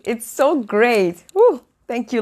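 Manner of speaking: ordinary talk, nothing marked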